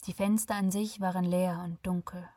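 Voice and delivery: usual speaking voice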